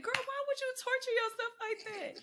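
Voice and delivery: high, laughing mock scold; playful high-pitched scolding; teasing disbelief. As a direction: lightly chide a friend for rewatching. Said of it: "I said".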